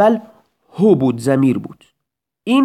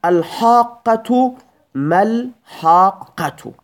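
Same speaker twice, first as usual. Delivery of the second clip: slow recited example; 5 semitones higher, much slower than usual. Shown as a demonstration. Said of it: "سومین مثال".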